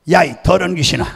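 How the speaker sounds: imitating a pastor's angry bellow; thundering rebuke. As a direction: roar abuse at an evil spirit. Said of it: "막 호통을 치는 거예요".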